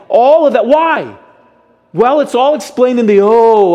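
loud, high preaching cry; impassioned climax. Real, not acted. Preacher asking why God instructs Israel, loud and impassioned.

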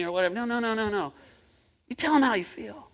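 usual speaking voice

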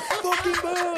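high loud mock boos; crowd booing. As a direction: boo like a whole hostile crowd.

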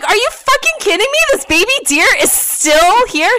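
high, loud incredulous outburst; retold disbelief. Daughter learning the deer is still there, retold disbelief. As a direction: exclaim in outraged disbelief, drawing out the end.

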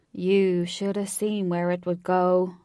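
loud, drawn-out Southern cowboy drawl; old movie cowboy with a slow Southern twang; slow Southern American. A narrator's reading. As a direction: speak like a big, slow-talking Southern cowboy. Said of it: "in a slow Southern American voice".

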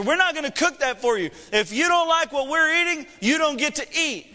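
high-pitched, exasperated dad laying down the law; worked-up parental lecture; mock stern parent. Dad lays down law to daughter.

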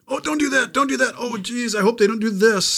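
high, pleading "don't do that"; anxious reader pleading. Retold or imitated. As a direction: beg the character not to do it, voice up high. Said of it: "to express that angst that you're feeling for the character".

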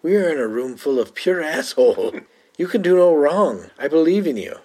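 a hint of a theatrical Nadja. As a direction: coax like a dramatic vampire. Voicing Nadja quoting show.

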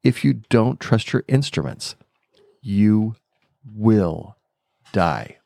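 retold warning, final words one by one; dramatic repeated warning. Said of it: "And he said it again".

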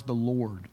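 "the Lord" marked as the key words; stress on the Lord. Shown as a demonstration. Pastor flagging the words he'll emphasize, gently. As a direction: lean into "the Lord". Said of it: "I want to emphasize the words".

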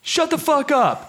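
mock shout, pitch jumping high; high shouted command. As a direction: yell it out like a bold sanguine friend. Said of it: "a sanguine friend of mine will be like".

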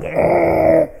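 a light mock yap of a small dog; just a quick little dog yap; imitated yap. Chihuahua barking back.

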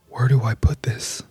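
hushed and at a loss; helpless whisper. Retold or imitated. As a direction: whisper it, stumped.

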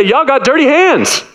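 high, slow, mock-accusing drawl; mocking Pharisees. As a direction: point the finger and sneer like a scold. Pharisees accusing, pointing the finger.

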